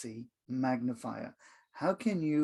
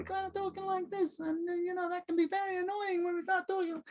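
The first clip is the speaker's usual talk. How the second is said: very high, squeaky put-on voice, held monotone; high pitch; 5 dB quieter than usual. Shown as a demonstration. Coach showing how annoying a high voice is, squeaky high pitch. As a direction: pipe it out annoyingly high and softer. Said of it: "are we going for the high pitch".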